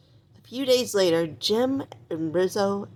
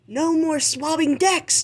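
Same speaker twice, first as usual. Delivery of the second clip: much higher joyous cheer; cheering pirate; 9 semitones higher, 4 dB louder than usual. Acted. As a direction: celebrate the end of chores. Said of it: "cheered one".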